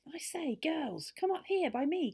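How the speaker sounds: put-on high call down to the girls; excited shout. A narrator's reading. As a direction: shout for them to come up. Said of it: "suddenly he gave a shout".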